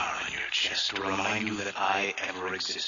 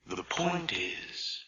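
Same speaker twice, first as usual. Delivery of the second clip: ordinary delivery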